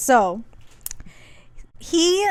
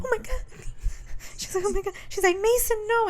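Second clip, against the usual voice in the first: much higher, hammed-up shocked voice; mock shocked gasp; 10 semitones higher than usual. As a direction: imitate a scandalized high squeak. Retold girl's shocked reaction.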